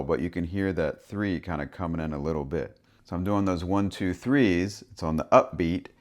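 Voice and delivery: ordinary talk, nothing marked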